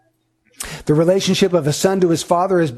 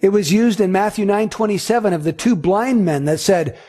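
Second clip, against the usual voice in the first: normal talking voice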